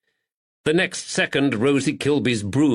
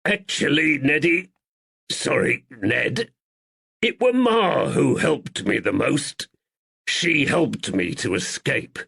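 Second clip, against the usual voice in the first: slight squeak; 4 semitones higher than usual. Acted. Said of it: "He spoke slowly and with a slight squeak".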